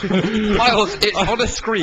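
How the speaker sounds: loud, high call to a co-host. Real, not acted. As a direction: call it out across the studio. Prompting co-host loudly.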